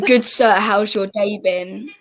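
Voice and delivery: slow, deliberate British model phrase; British accent. Shown as a demonstration. Brit giving an American a line to copy, slow British accent. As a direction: give a measured, proper British line to copy. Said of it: "give me something to say, and I'll try to copy you".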